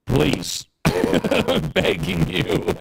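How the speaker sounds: begging through laughter; laughing plea. Real, not acted. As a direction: laugh while pleading. Jokingly begging the host for more.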